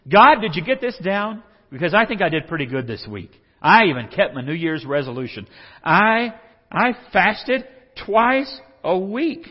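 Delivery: loud, high self-congratulating prayer; boastful praying. Acted. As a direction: show off to God. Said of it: "Like he's trying to impress God".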